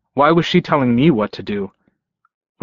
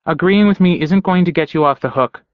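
higher-pitched, pointed warning; 6 semitones higher than usual. A narrator's reading. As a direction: warn them they are still in trouble. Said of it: "she warned me".

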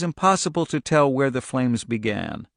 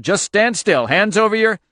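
higher, louder command; yelled order; 8 semitones higher, 5 dB louder than usual. A narrator's reading. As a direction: keep yelling orders, gun drawn. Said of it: "one of them yelled".

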